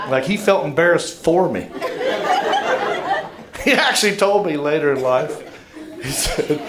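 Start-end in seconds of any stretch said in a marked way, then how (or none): 1.7-3.3 a high held squeal of laughter. Real, not acted. Comedian cracks up over dad's embarrassment, high squealing laugh. Comic laughing at own story.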